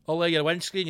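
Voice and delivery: ordinary talk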